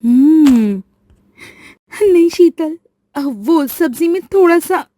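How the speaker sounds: hesitant. Acted. Rajni hesitates to admit mistake.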